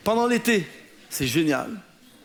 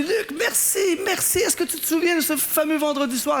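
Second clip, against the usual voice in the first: put-on grateful young woman; 5 semitones higher than usual. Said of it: "elle me dit".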